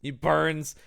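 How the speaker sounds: ordinary delivery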